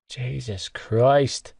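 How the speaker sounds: slow, drawn-out groan. Acted. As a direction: groan it out slowly. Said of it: "I groaned".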